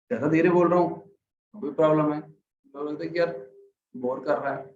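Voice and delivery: ordinary delivery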